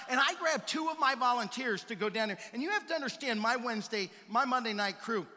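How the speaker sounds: ordinary delivery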